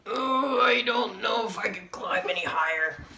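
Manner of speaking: strained. Acted. Character climbing wall.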